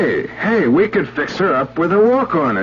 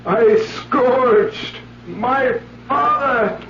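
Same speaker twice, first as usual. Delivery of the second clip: slow, rising anguish; stagey remorse building; anguished acting; 9 semitones higher, much slower than usual. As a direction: drag out each line in torment. Actor performing anguished scene.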